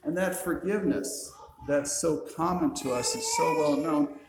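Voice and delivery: normal talking voice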